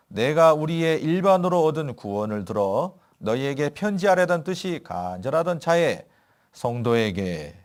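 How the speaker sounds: slow, solemn Bible reading; scripture reading. Acted. Pastor reading Jude to the church, solemn and slow. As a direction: read the verse slowly and gravely. Reads Bible verse aloud.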